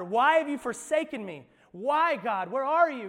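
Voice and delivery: loud, high anguished cry. Acted. Jesus on the cross crying to God, loud anguish. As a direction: cry out to the Father. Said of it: "he cries out".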